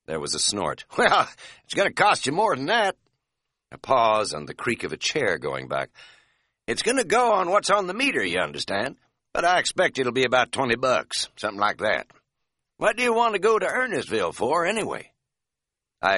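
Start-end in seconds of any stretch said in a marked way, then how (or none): 1.0-3.0 high, loud country cabbie with a grin in it; brash Tennessee cabbie enjoying the joke; feisty cab driver. A narrator's reading. Narrator voices feisty cab driver. 6.7-15.1 brash Tennessee cabbie enjoying the joke; feisty cab driver. A narrator's reading. Narrator voices feisty cab driver.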